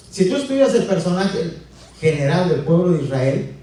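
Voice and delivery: normal talking voice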